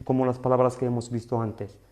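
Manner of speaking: usual speaking voice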